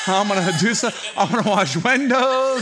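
laughing. Real, not acted. Boasting to the church about his shirt, laughing. Joking about ground crew work.

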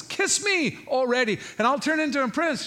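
high, insistent, impatient frog; hammed-up impatience, pitched well up; exasperated demand. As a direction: plead as if fed up with asking. Frog's third, impatient request.

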